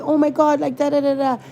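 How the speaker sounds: high mock outburst; going off. Imagined outburst at the young lady, high and exasperated. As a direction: pretend to go off on her. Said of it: "go off and be like".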